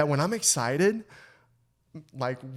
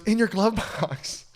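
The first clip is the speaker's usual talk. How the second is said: mom laughing; 6 semitones higher than usual. Retold or imitated. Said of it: "She goes".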